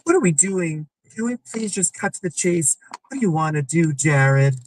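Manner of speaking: put-on high, exasperated girlfriend voice; mock girlfriend. Girlfriend demanding an answer.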